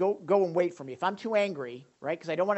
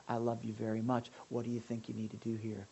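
softer, gentle and close; tender reassurance; 5 semitones lower, 9 dB quieter than usual. Retold or imitated. Father holding his son on his lap, tender. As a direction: comfort a child on your lap.

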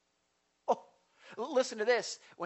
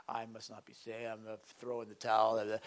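much lower and much softer, defeated; mock despairing voice; 8 semitones lower, 8 dB quieter than usual. Preacher voicing the enemy's lie, low and soft. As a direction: sink into a hopeless mumble. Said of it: "The enemy wants to condemn you to say".

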